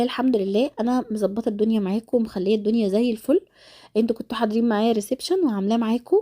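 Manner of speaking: normal talking voice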